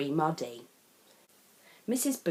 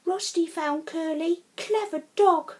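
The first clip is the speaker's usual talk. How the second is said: high, louder praise; praising child; 10 semitones higher, 5 dB louder than usual. Acted. Sam proudly praising Rusty for finding Curly.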